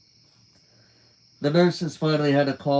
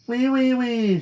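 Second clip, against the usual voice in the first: mocking squeal; 10 semitones higher, 3 dB louder, slower than usual. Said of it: "wiggled it at me".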